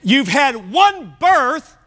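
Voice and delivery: "birth" said slowly and clearly, in full; slow, emphatic correction of "one birth"; slowed down, careful. Real, not acted. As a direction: spell out "one birth" with deliberate weight. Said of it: "Slow down and just say the word".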